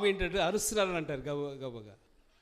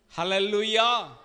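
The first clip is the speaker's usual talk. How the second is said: louder, drawn-out praise call; loud hallelujah; 7 dB louder, slower than usual. Real, not acted. Preacher praising God with congregation, loud call.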